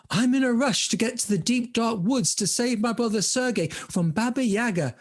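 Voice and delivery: high, urgent voice of Olga; hurried heroine. Acted. Olga rushing to save brother.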